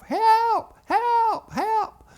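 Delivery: very high, loud acted screams; screaming heads off. Two little boys locked in the well house, screaming. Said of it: "We began to scream our heads off".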